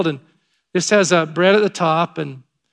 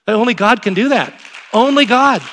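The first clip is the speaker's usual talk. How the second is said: raised, louder exclamation, building on the repeat; emphatic awe; 6 semitones higher, 6 dB louder than usual. Real, not acted. Pastor marvelling to the congregation at God's doing, emphatic awe. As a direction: proclaim it with rising conviction. Preacher exclaims over amazing story.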